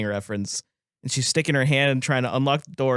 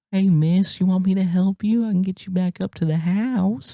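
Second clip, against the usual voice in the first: sweet helpful voice; 7 semitones higher than usual. Retold or imitated. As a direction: offer help in a put-on sweet tone. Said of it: "she's like".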